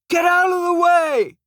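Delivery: high, loud furious yell; angry yell. Acted. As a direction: bellow it from a car window. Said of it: "He shakes a fist and yells".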